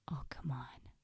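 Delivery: soft, sneaky coaxing; very quiet. Acted. Voice in your head tempting you, very quiet. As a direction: slip it in softly. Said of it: "It's usually very quiet".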